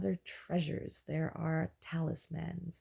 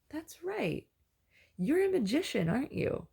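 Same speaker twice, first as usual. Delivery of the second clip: much higher, bright excited question; eager recognition; 9 semitones higher, 3 dB louder than usual. Retold or imitated. Dreamer recognizing the magician, much higher and excited. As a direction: suddenly place him, thrilled. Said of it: "and I go".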